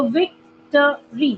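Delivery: Victory in three distinct pieces; syllable by syllable. Shown as a demonstration. Teacher repeating the example for students, victory split by syllable. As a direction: pause twice inside victory. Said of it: "three times बोल रही हूँ मैं words".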